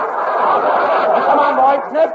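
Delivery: usual speaking voice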